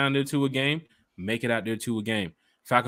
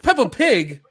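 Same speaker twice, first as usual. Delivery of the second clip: put-on high, indignant British-accented question; mock British accent; 10 semitones higher, 6 dB louder, slower than usual. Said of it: "was that your Peppa Pig accent".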